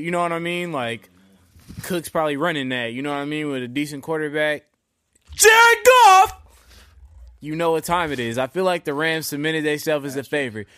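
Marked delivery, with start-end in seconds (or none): 5.3-6.4 very high, very loud name call; hype shout. Acted. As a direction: holler the name like a hype man.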